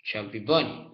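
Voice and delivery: talking with a full mouth; cheeks stuffed full. Real, not acted. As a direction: say it through cheeks full of marshmallows. Mouth crammed with marshmallows.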